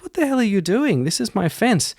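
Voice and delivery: high-pitched, indignant outburst; annoyed farmer. Acted. Farmer catching a stranger dismantling the fence, annoyed. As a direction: challenge them in a high, outraged voice. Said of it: "farmer is obviously a little bit annoyed".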